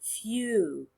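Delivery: "Few" with a drawn-out vowel; long vowel. Shown as a demonstration. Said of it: "is long".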